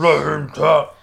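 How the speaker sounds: loud, high put-on outcry; hammed-up imitation of a protest; mock protest yell. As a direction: yell it like a fighter in denial. Repeats the mocking protest louder.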